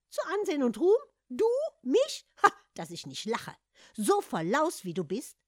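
high, loud scornful laugh-talk; mock the cat's boast. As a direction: Hans mocks the cat, loud and high. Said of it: "erwiderte spöttisch".

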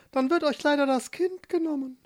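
high, cooing bird voice; dove voice. Acted. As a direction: speak like a fretful little dove. Doves voiced in story.

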